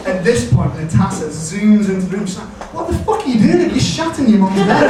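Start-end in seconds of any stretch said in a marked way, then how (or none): 2.7-4.9 high-pitched, appalled imitation of a housemate; outraged outburst. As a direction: burst out in mock outrage, pitched up. Housemate bursts in on the mess.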